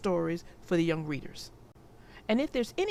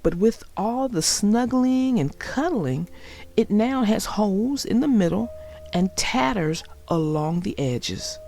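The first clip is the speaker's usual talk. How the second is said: put-on clear, lively read-aloud for young children; storybook narration; 8 dB louder than usual. A narrator's reading. Narrator reading Franklin's Blanket to young viewers, storybook read-aloud. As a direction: tell the story warmly to little listeners.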